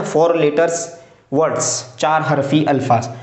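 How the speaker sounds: ordinary talk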